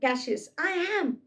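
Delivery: hint of a hurt Cassius; Cassius insisting. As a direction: play Cassius protesting his own name. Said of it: "brute".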